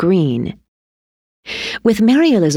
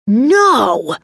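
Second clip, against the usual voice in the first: raised, drawn-out cry of protest; scream; 5 semitones higher, 4 dB louder than usual. A narrator's reading. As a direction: cry out in one long protesting word. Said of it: "Timmy screamed".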